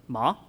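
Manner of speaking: "ma" in a low tone with a rise; "ma" dipping, then coming up; Mandarin tone demo. Shown as a demonstration. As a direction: start "ma" low and let it lift. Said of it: "differentiate them is by tones".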